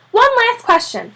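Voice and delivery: high, loud eager voice; high excited reporter; excited young man. Acted. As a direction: spot your target with glee.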